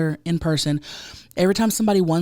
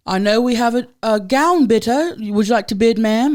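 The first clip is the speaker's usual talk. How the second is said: higher, louder British auctioneer; imitated British accent; 5 semitones higher, 6 dB louder than usual. British auctioneer calling on the gown bidder, mock British accent. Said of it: "the British guy was like".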